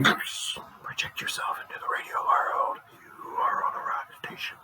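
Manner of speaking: breathy hushed whisper, drawing the listener in. Acted. Host whispers to listeners to imagine radio. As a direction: murmur it like a secret invitation. Whispered guided imagery intro.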